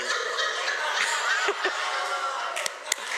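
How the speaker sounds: high breathy burst of laughter. Real, not acted. Preacher cracking up after the joke, high laugh. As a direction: laugh out loud, high and airy. Couple teasing on stage.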